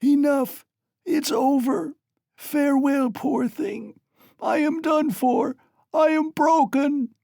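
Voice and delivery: high, loud despairing cry; dying woman hurling her last despair; vindictive despair. A narrator's reading. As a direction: cry out in bitter defeat. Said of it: "she cried with vindictive despair".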